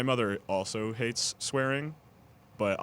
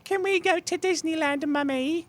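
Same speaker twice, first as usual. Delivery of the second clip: put-on much higher, loud British accent, "Mummy"; posh British child; 8 dB louder than usual. Asked about saying mum, friend hams up a high British child. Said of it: "Throw 'em with that British accent".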